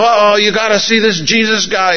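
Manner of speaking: a buddy bursting with good news; excited buddy. Retold or imitated. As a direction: tell them like a friend who can't wait. Buddy voiced in retold scene.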